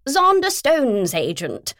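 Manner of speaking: slow, high and loud posh voice; posh woman. Acted. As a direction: announce it grandly. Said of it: "she went on".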